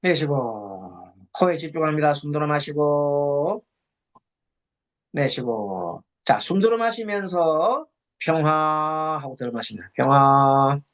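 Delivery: slow, level intoned meditation instructions; low, measured meditation-leader monotone; guided breathing meditation. Acted. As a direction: lead the breath slowly, drawing out each phrase ending on a held note.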